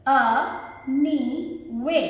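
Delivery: अ, नी, वे, each alone with a pause; three separate syllables. Shown as a demonstration. Teacher showing three syllables, each said separately. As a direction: count out "अ, नी, वे" as isolated beats. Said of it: "three syllables".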